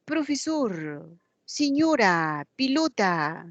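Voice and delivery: "Profesor, señora, pilota" with o and e turned into u and i: prufisur, siñura, piluta; slow, e said as i and o as u in "Profesor", "señora" and "pilota"; Quechua vowel swap. Retold or imitated. Pupil Juanito answers the teacher, swapping e to i and o to u. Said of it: "por qué dice pilota y no dice pelota".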